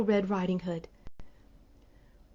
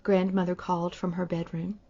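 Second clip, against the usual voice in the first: ordinary delivery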